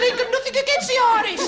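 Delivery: high, loud protesting reply; insist, mock offended; indignant protest. Passenger answering the bus conductor, loud and high.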